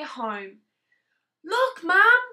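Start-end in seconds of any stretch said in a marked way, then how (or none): 1.4-2.2 higher, louder proud call; bursting with pride; excited calling. Acted. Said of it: "said Tadpole".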